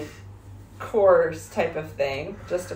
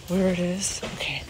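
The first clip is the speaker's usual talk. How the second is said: whispered in a silent yoga space. Real, not acted. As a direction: hush it so you don't disturb anyone. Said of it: "So Whisper Zone".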